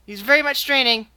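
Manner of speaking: ordinary talk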